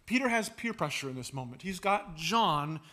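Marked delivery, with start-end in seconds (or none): none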